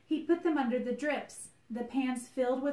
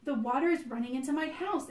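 upset complaint; 5 semitones higher, 5 dB louder than usual. Acted. Upset bunny accusing rabbit.

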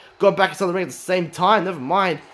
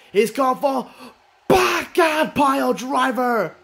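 excited call; 5 semitones higher, slower than usual. Real, not acted. Calling a big move.

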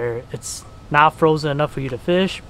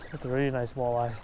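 hushed awe; 2 semitones lower, 10 dB quieter than usual. Real, not acted. Hushed awe over keeper.

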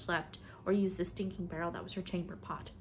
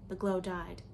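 ordinary delivery

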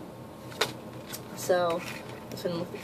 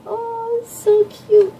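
much higher, louder cutesy squeal; gushing squeal; 6 dB louder, much slower than usual. Real, not acted. Manga fan gushing over little Mitsuki, high squeal. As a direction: squeal like you can't contain it.

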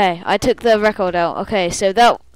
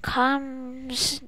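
slow, drawn-out chant, rising higher; ethereal voice; 6 semitones higher, slower than usual. Acted. Spirit voice speaking to the traveller, ethereal chant. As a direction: intone it like an otherworldly oracle. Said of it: "you hear an ethereal voice".

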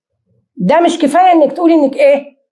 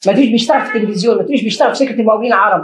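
fast warning; 2 semitones lower, faster than usual. Real, not acted.

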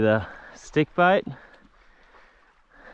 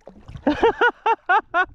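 squealing high laugh; high giggle. Real, not acted. Angler laughing after the cod chase, high giggle. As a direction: burst into a giddy laugh.

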